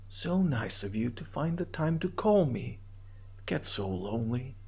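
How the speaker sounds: a hint of a lonely hundred-year-old woman. Caller plays a lonely ancient woman on the payphone, lightly voiced. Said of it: "The voice is female and sounds about a hundred years old".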